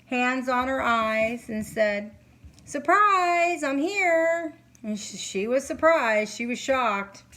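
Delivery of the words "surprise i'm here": "Surprise" called out high and louder, like a cheerful surprise; hammed-up playful greeting from behind; mock cheerful surprise. Stepdad surprising her, acted quote.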